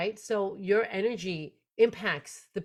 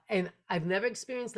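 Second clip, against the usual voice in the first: ordinary talk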